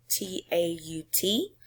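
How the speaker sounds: ordinary talk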